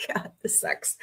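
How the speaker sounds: whispered. Real, not acted. Uncomfortable, muttered aside.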